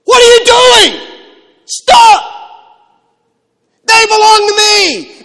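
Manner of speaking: very loud, high shout; indignant fury. Acted. Jesus rebuking disciples for pushing children away, furious shout. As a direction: roar it out, drawing out the final words. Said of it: "He's really mad".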